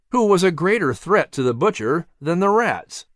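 ordinary delivery

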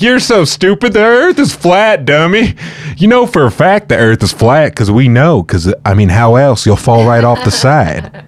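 high, slow condescending sneer; mocking voice. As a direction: talk down to them like a smug idiot. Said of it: "He's like".

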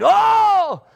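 high, loud drawn-out "oh"; hammed-up movie scream; mock scream. Christians in seventies end-times movies, mock scream. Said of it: "portray Christians as screaming and yelling".

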